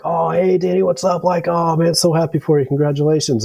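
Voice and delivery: high, mock-friendly voice imitating his buddies; exaggerated chummy hello, high; mock cheery greeting. Buddies greeting new lieutenant awkwardly.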